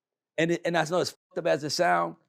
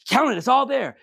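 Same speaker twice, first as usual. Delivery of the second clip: eager; 6 semitones higher, 6 dB louder than usual. Retold or imitated. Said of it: "He's like".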